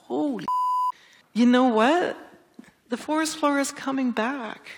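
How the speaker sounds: hushed "holy", then a high, wondering reveal; retold amazement. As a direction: relive the astonishment at good news. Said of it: "I'm looking at the data and I'm going".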